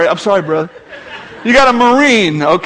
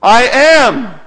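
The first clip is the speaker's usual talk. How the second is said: "I am" declared louder, firm and solemn; solemn declaration; 4 semitones higher, 6 dB louder than usual. Retold or imitated. Jesus declaring the divine name, loud and solemn. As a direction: proclaim "I am" as the name of God. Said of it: "the name of God from the Old Testament".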